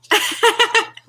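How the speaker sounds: real, high peal of laughter; high, helpless giggling fit; laughing hard. Real, not acted. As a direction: burst out laughing at your own tease. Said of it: "why I'm laughing so hard".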